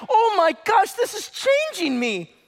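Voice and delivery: high, loud gasp of wonder, slowing at the end; amazed realisation. Retold or imitated. Believer discovering scripture changes him, amazed cry. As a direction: voice a believer struck with awe. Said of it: "and you're like".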